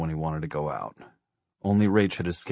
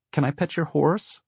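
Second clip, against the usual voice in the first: put-on high-pitched small child's voice; little boy asking; 9 semitones higher than usual. A narrator's reading. Four-year-old asking the horse lady, high child's voice. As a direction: ask hopefully in a little boy's high voice.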